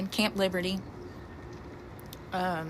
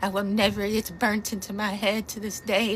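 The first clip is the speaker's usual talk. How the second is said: ordinary talk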